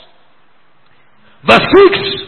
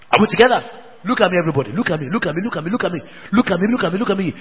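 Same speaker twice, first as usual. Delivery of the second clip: low and much softer, insistent repetition; intense hushed plea; 6 semitones lower, 10 dB quieter, much faster than usual. Real, not acted. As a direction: pull them in with a low urgent repeat. Pleading with congregation.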